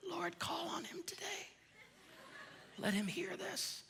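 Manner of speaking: whispered, high, teasing prayer; mock prayer whisper. Preacher teasing a husband in the crowd, whispered prayer. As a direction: whisper a joking prayer for him.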